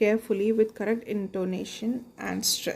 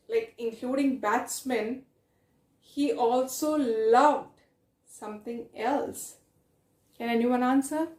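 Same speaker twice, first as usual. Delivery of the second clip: ordinary talk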